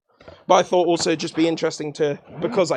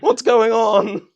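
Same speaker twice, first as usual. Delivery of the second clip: laughing; 5 semitones higher, 9 dB louder than usual. Pretend. Mock nausea from spinning mic.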